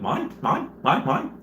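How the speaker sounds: short, repeated squawks with a rising lift; seagulls from Finding Nemo. Retold or imitated. Streamer comparing the mob to cartoon gulls, squawking mimicry. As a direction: imitate a flock of greedy cartoon gulls. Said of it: "those seagulls from Finding Nemo".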